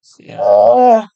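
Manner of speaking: words stretched through a big yawn. Real, not acted. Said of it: "je baille".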